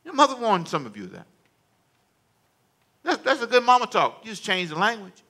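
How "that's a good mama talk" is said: normal talking voice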